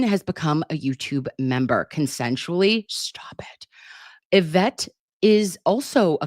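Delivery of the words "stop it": breathy whisper, embarrassed and amused; whispered stop it. Real, not acted. Livestreamer, teased by a chat member, whispers. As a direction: hush it out like a flustered secret.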